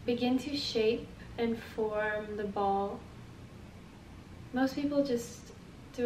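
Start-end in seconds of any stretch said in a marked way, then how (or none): 0.1-3.0 soft, unhurried guiding voice; guided meditation. Acted. Teacher leading listeners through feeling their energy, guided meditation. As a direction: guide them gently through the exercise, leaving space. Leads breathing with the movement.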